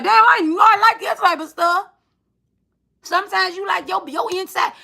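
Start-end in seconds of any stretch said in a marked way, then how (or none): 0.0-1.9 high, loud burst of surprise; a loud, high squeal of delighted shock; surprised exclaim. Retold or imitated. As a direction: blurt out the sudden discovery, half-shouting. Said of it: "it unlocks something like".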